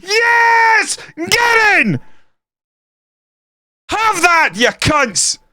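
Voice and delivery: very high triumphant roar; goal celebration yell. Real, not acted. Fan yelling at the opponents after a winner, triumphant roar. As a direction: yell it out the open window. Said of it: "some guy yelling out their window".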